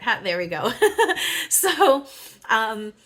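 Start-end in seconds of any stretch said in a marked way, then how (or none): 0.8-1.4 a sudden high squeal of laughter. Real, not acted. Spotting the healing heart card, high laugh. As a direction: let out a delighted high laugh.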